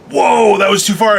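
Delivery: loud, high 'whoa' from the crowd; shocked audience objection. Acted. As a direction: cry out as if a line was crossed. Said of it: "everyone goes".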